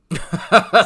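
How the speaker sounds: sudden loud laugh; high burst of laughter. Real, not acted.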